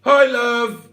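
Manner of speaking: high, loud call upstairs; shouted greeting. Pretend. Man calls upstairs to his lover so as not to alarm her, shouting. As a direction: call out to someone up the stairs. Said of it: "So I'd shout".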